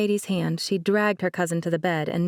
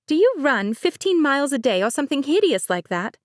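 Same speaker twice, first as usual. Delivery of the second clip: light higher-pitched bride, laughing it off; Mercedes voice; 8 semitones higher, 4 dB louder than usual. Acted. Bride Mercedes teasing her cousin, higher light voice. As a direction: play the bride breezily.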